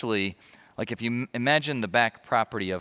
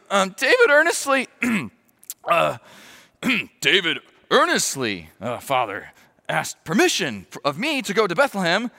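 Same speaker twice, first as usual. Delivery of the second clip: nervous excuse; 7 semitones higher, 6 dB louder than usual. Acted. Jonathan nervously covering for David.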